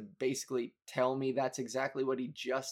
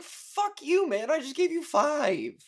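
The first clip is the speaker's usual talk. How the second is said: retold angry retort; 12 semitones higher, 4 dB louder than usual. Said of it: "And I was like".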